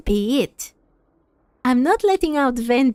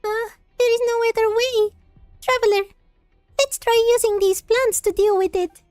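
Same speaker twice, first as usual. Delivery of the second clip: much higher, fast, chirpy sidekick; high cartoon voice; 11 semitones higher than usual. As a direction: squeak it out like a tiny companion. Reads sidekick lines.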